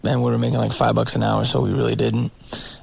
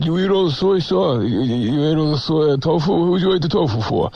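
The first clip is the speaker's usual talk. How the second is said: mock Brooklyn scolding; 4 semitones higher than usual. Imitating Brooklyn vegan owners scolding.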